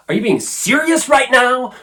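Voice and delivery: a playful jab of disbelief at a text; incredulous. Pretend. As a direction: toss it off as if you can't believe the message. Imagined reply to a text.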